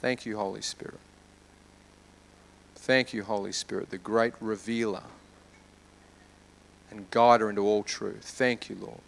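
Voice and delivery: soft, low prayer with long pauses; hushed prayer. Real, not acted. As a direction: pray gently, letting the voice sink. Leads a prayer.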